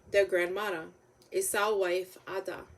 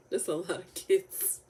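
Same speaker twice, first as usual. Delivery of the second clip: laughing aside. Real, not acted. Laughing aside at long list.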